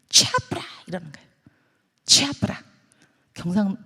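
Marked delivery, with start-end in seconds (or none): 0.1-0.6 abrupt high-pitched Gyeongsang dialect snap; Gyeongsang granny. Acted. Gyeongsang granny refusing a haggler, curt dialect dismissal. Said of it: "경상도 할매가". 2.0-2.6 short, sharp Gyeongsang refusal; Gyeongsang granny. Acted. Gyeongsang granny brushing off a cheap buyer, abrupt dialect snap. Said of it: "경상도 할매가".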